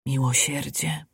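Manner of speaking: soft echo like wind among trees; quiet voice. A narrator's reading. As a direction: let it drift softly, like wind. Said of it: "głos cichy, jakby wiatr między drzewami". (cichy głos jak wiatr)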